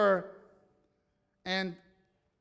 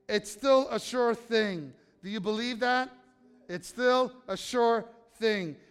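louder, driving sermon read of Hebrews; preaching a scripture reading; 3 semitones higher, 5 dB louder, slower than usual. Acted. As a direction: preach the passage with conviction, slowing on key lines. Reads Hebrews passage.

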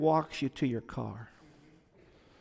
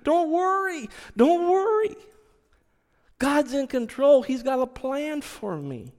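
fervent reassurance; 7 semitones higher than usual. Retold or imitated. Consoling old boss in embrace.

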